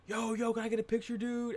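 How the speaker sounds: high-pitched, eager calling out; excited fan request. Retold or imitated. Fan asking the Smo for a picture, excited.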